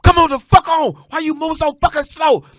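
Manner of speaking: loud, high barking father; a hard dad shouting at his baby; harsh yell. Retold or imitated. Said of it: "he said this shit so hard".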